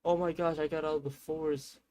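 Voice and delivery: high, stunned mock disbelief; half-acted shock at finding the road; disbelieving relief. Lost uncle finally finds the road, high mock disbelief. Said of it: "and then he was like".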